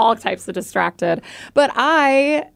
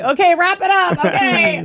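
higher, mock yell to wrap up; imitated yelling; 6 semitones higher than usual. Co-host mimicking B J yelling to wrap it up, mock yell. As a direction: cut them off with a pretend yell. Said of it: "B J just yelling at you".

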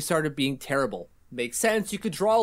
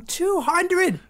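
drawn-out, higher-pitched milestone hype; hyped emphasis; 12 semitones higher than usual. Real, not acted. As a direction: stretch the number out excitedly. Host hyping the milestone.

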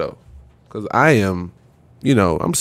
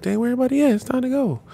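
confused rookie; 13 semitones higher, 5 dB quieter, much faster than usual. Retold or imitated. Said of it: "I'm like".

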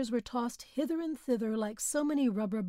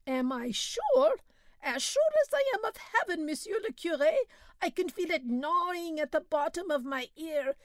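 complaining peasant; 7 semitones higher than usual. A narrator's reading. Suffering peasant Belhomme voiced.

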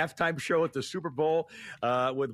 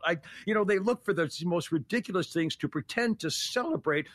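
usual speaking voice

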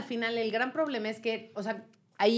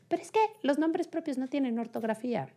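higher-pitched imitation of an objector; raised-pitch protest, imitated; mock objection; 5 semitones higher, 3 dB quieter than usual. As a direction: object like a doubting listener. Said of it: "mucha gente seguramente estará diciendo ahorita así".

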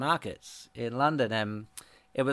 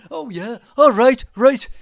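higher, loud mock Liverpool accent; jokey Scouse voice cranked way up; 11 semitones higher, 11 dB louder than usual. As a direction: ham up a cheery Liverpool accent. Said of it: "the only English accent I can do is, like, [name]".

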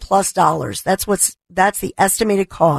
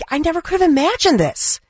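real outrage; 9 semitones higher than usual. Real, not acted. Host telling viewers of election interference, real outrage. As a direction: voice jumps high in real outrage. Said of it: "And I'm angry about it".

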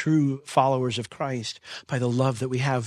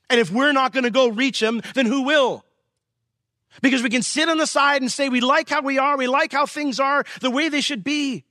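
louder, higher impassioned preaching; preaching at full force; 9 semitones higher, 4 dB louder than usual. Acted. Pastor challenging the church to reach lost kids, impassioned. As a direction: drive the challenge home. Passionate climax of sermon.

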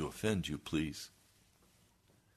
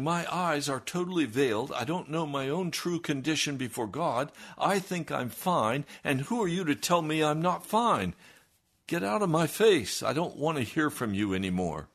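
high, loud mocking voice of a blind churchgoer; hammed-up outrage, pitched up and loud; mocking churchman; 9 semitones higher, 6 dB louder than usual. Pastor mocks the offended minister to his congregation, in a high, loud, hammed-up voice. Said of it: "He's saying".

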